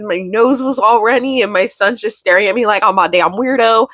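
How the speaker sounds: ordinary delivery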